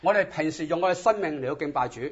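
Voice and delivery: normal talking voice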